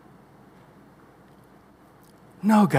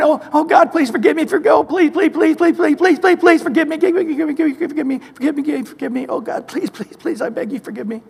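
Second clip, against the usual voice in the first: panicked plea that dies away to near silence; frantic begging prayer; 2 semitones higher than usual. Retold or imitated. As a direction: beg God for mercy, then sink into frightened murmurs. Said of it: "and we're going".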